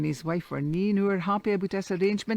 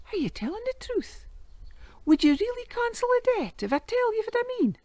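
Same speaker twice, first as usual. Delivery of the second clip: put-on boy's voice. Boy checks the offer.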